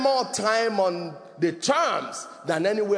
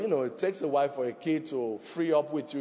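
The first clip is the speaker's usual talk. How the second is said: ordinary talk, nothing marked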